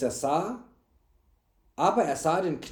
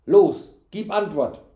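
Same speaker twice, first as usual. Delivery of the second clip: loud, higher-pitched order; angry command; 4 semitones higher, 9 dB louder than usual. A narrator's reading. Knight shaking servant for answers, angry command. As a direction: bark the demand.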